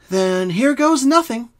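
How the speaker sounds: bracing Twilight. A narrator's reading. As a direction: take the plunge, slowly. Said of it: "Twilight said, taking a deep breath".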